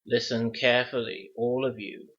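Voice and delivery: ordinary delivery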